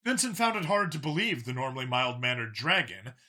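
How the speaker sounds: usual speaking voice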